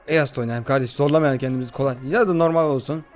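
ordinary talk, nothing marked

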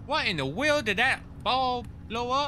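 high-pitched Texan character voice; Texan accent. Acted. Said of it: "you gotta go full Texan".

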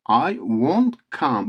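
slow, drawn-out won't with rounded w; model pronunciation of won't. Shown as a demonstration. Teacher translates the negative for students, slow careful won't. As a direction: say won't slowly with a w, not v. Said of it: "Won't gibi yapın".

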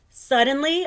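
high and loud suddenly; dramatic. A narrator's reading. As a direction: build suspense. Narrator dramatic moment.

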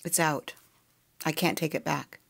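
ordinary delivery, nothing marked

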